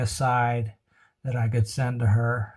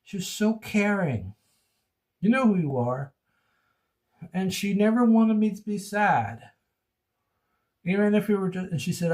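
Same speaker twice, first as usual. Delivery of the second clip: ordinary delivery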